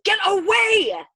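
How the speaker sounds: drawn-out high, loud shout; frantic scream. A narrator's reading. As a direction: scream it long, at full desperation. Punching him, driving him away.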